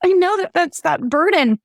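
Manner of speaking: holding back tears while telling it; tearing up. Real, not acted. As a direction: voice lifted with real emotion on the edge of crying. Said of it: "I just had a flashback to that".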